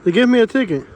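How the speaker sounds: ticket said high and loud, falling flat; stunned disbelief. Real, not acted. Vlogger reading his ticket to the camera, loud disbelief.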